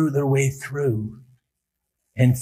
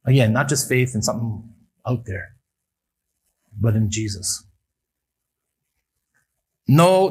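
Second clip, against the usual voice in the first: usual speaking voice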